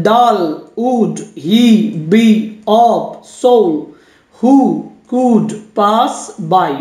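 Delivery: very slow, one beat per word; deliberate scansion demo, word by separate word; syllable by syllable. Shown as a demonstration. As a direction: set each syllable apart with a pause. Counting syllables of a sonnet line.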